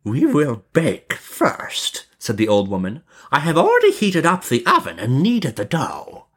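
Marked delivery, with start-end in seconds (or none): 0.0-2.0 high, very drawn-out witch voice; scheming witch. A narrator's reading. Witch gives orders. 3.3-6.3 high, lilting witch voice; scheming witch. A narrator's reading. Witch gives orders.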